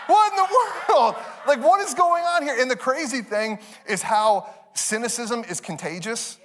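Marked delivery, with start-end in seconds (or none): 0.0-2.5 high, loud exasperated outburst; frustrated disbelief. Retold or imitated. Dad reacting to cynical kids, high exasperated outburst. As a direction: blurt it in utter bafflement. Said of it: "I'm getting frustrated".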